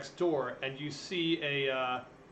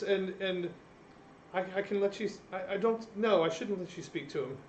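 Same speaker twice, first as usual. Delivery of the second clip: higher, fast, stammering servant voice; nervous servant; 7 semitones higher, 5 dB quieter than usual. Acted. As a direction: stutter it out, flustered and anxious.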